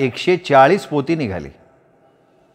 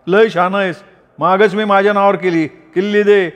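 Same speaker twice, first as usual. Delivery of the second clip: brother's retort; 7 semitones higher than usual. A narrator's reading. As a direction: sneer back, lightly acted. Brother demands key. (भावाचा उपहास)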